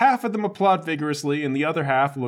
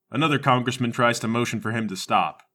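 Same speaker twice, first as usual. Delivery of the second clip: ordinary talk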